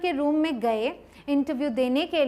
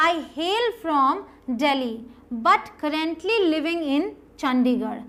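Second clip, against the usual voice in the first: slow model sentence; much slower than usual. Shown as a demonstration. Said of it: "या फिर".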